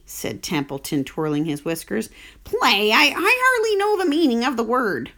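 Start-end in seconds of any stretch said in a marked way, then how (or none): 2.4-5.1 higher, sneering rat voice; a rat mocking the very idea of play; scornful rat.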